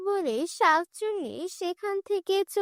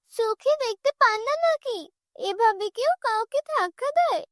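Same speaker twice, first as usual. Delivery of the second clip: higher-pitched, affronted protest; indignant scolding; 7 semitones higher than usual. Acted. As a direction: scold someone who has just shoved you. Shoved woman scolds the chief's wife.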